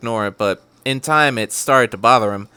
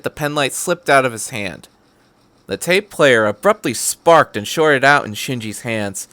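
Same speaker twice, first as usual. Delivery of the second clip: ordinary delivery, nothing marked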